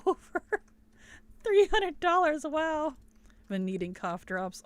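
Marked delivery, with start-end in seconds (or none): 0.1-3.0 shocked rising exclaim; surprised. Real, not acted. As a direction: jump up in disbelief. Shocked by DLC total.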